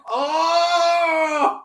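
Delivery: very high, held-out "oh"; mock realization. Patient told that's trauma, hammed-up realization. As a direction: exaggerate the revelation in a high, sustained cry.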